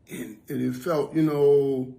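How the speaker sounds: ordinary talk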